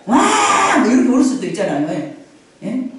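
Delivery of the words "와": put-on mighty baby wail. Baby Moses crying mightily.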